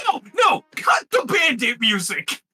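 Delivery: high shouted no, no, slowed at the end; bandit shout. Acted. Bandit yells at the party to cut the music, shouting.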